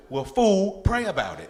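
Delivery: a fed-up mom yelling at her son to pray; scolding mom. Retold or imitated. Mom shouting at her son to just pray.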